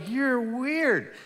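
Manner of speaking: high, loud mock-teasing; teasing friend. Teasing his friend about the rubber band, in a high voice. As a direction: tease him in a put-on voice. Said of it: "I go".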